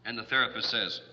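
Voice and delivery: normal talking voice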